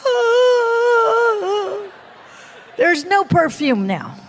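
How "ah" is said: long high mock wail; helpless wail. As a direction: wail wordlessly on one drawn-out high note. Acts her wordless reply.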